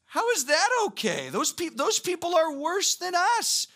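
high, loud, outraged protest; indignant whine. Retold or imitated. Habakkuk protesting to God about the Babylonians, high indignant whine. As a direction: pitch it up high like a prophet scandalized by God. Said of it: "to which he goes".

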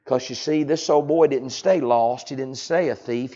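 ordinary delivery

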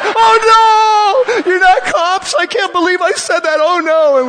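flustered waitress caught out, shrieking in mock horror; mock freaking out. Retelling the waitress who realizes she called pastors cops, mock panicked shriek. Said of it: "she starts freaking out".

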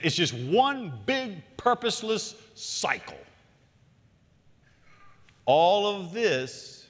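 very slow, each word stretched out; drawn-out gloom. Acted. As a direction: drag the words to make life sound endless. Preacher dwelling on meaninglessness.

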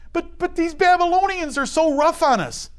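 high, complaining voice of a frightened exile; whiny fearful exile. Retold or imitated. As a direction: whine it like a scared captive.

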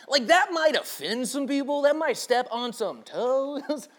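put-on voice of timid concern; mock cautious feedback. Imitates his team's cautious feedback.

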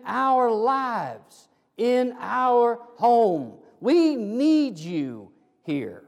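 slow, drawn-out pleading prayer; earnest plea. Acted. Family tells the Lord they need him, slow earnest plea. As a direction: stretch each phrase, leaning on the key words.

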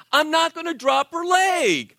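high, pushed protest, lightly amped. Retold or imitated. New dad to the nurse, high and amped up. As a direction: insist you won't, all wound up. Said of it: "I'm talking like this to her".

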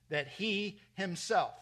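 "he himself" slowed and weighted; emphasis on he himself. Shown as a demonstration. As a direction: lean into "he himself" to show it's personal. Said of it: "it's emphatic".

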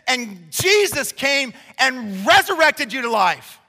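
loud, driving pulpit delivery; rising sermon fervor; full-force preaching. Acted. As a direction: preach it hard to the congregation.

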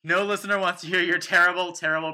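loud, high, laughing mock panic; hammed-up alarm at yourself; self-scolding. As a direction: abort the accent in a hurry. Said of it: "and then I was like".

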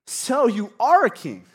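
high, loud mock-surprised question; insincere. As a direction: pounce on it, insincerely. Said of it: "Pilate is being so insincere".

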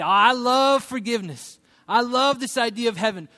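put-on eager raving; mock enthusiasm. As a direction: play the over-keen believer. Voicing people who love forgiveness only.

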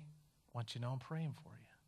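soft, low and steady, without rebuke; gentle quiet reassurance. Retold or imitated. Teacher to mocking students, softly reassuring. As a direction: answer the teasing kids softly and calmly.

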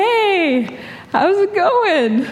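high, slow, cheery hello with a laugh; mock-casual hi to a stranger; friendly greeting. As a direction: greet a stranger a bit awkwardly.

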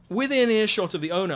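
ordinary delivery, nothing marked

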